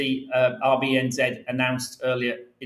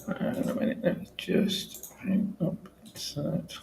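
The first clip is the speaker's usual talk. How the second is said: off mic; slower than usual. Real, not acted. Still searching files, off mic.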